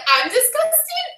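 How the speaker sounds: high, drawn-out incredulous question; indignant disbelief. Retold or imitated. Tenant answers the neighbour's insult, high and incredulous. Retells her indignant comeback.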